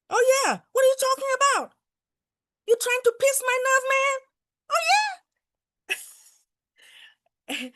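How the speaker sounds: high, loud and fast, hammed-up indignation; hammed-up high, loud comeback; mock indignant retort. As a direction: play-act snapping back at a rude stranger. Mock retort to rude Americans.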